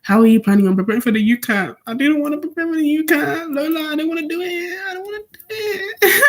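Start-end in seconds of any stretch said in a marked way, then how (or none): 1.8-6.2 high-pitched whiny fake cry; mock whining.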